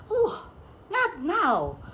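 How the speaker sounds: high, loud protest; cried out. A narrator's reading. Rich man waving off the servant, high cried protest. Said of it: "the man cried out".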